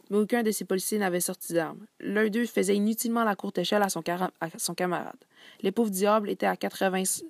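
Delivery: lively reading of a novel passage with voiced dialogue; story narration. A narrator's reading. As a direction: read the excerpt to the class like a storyteller. Student reads second novel excerpt. (lecture expressive d'un roman à voix haute)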